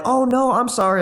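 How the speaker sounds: high-pitched mock-apologetic girl voice; imitated women apologizing.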